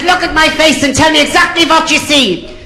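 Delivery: high, brusque interviewer voice; Nicky Lauder. Acted. Airline boss interrupting a pilot applicant, brusque. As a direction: cut in with a high, comic boss voice. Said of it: "And Nicky Lauder said".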